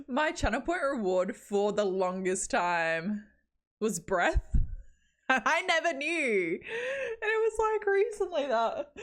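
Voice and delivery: breaking into laughter; laughing while talking. Real, not acted. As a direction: voice cracking with laughter. Laughing-crying story.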